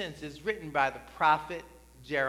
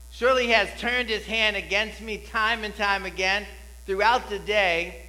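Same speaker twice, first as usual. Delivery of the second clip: scripture reading; 4 semitones higher, 5 dB louder than usual. Acted. Reads Lamentations aloud with fervour.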